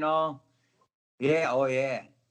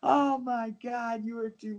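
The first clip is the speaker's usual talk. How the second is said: imitating laughing praise; 8 semitones higher, slower than usual. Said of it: "They were laughing so hard".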